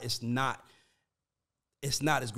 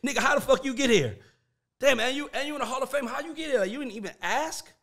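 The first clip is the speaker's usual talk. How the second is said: disbelief; 10 semitones higher, 5 dB louder than usual. Real, not acted. Incredulous rant about skipping steps.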